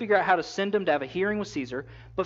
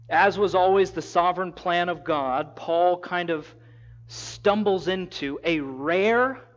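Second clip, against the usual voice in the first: ordinary talk, nothing marked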